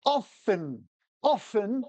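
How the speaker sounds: "often" as "offen", no T, set apart; silent-T OFTEN. Shown as a demonstration. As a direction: drop the T in "often" with mock insistence. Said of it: "how a British person says it".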